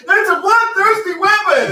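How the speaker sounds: loud high shout. Retold or imitated. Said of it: "and you're going".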